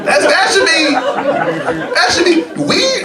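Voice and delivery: very high, squeaky and eager; eager dog. Retold or imitated. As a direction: beg in a tiny excited voice. Eager squeaky dog wanting weed.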